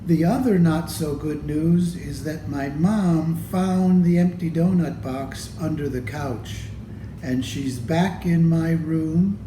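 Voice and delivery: usual speaking voice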